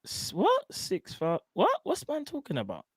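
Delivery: high, baffled mock questions; confused viewers. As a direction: play the puzzled chat, pitch jumping up. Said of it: "I'm seeing people saying".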